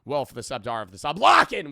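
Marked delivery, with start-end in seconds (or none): 1.2-1.7 loud, high rallying yell; hyped shout. Real, not acted. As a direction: fire yourself up and shout it. Streamer rallying himself to start.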